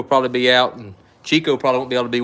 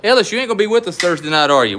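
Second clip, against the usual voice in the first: calling out higher, louder and fast; 6 semitones higher, 4 dB louder, faster than usual. Real, not acted. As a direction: raise your voice to Ellis across the room. Calling out to Ellis.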